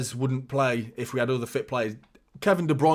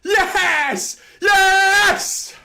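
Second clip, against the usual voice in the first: screaming the goal, much higher and louder; goal celebration; 8 dB louder, slower than usual. Real, not acted. Fan on stream celebrating City's goal against Liverpool, real ecstatic scream. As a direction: roar it out like the stadium just erupted.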